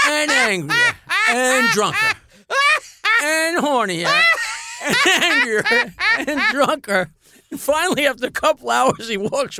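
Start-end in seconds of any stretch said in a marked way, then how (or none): 0.1-7.1 ramp each word up higher as he laughs; building, laughing. Real, not acted. Storyteller building to the punchline, rising in pitch and laughing.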